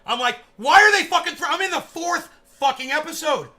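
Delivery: loud, high, indignant yelling; outraged shouting. Retold or imitated. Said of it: "I was like".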